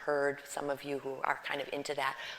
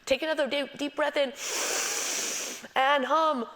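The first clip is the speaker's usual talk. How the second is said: higher, louder, upbeat exercise cues; guided breathing cue; 9 semitones higher, 6 dB louder than usual. Acted. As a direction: lead the group into the breath. Guides the class through exercise.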